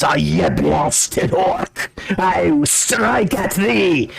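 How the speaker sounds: hammed-up scream, held back in volume; mock scream. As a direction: draw out the threat like a raging old dwarf. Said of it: "And he screams".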